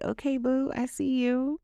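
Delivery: high, playful proud-mom praise; proud cheer. Retold or imitated. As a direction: hype her up affectionately.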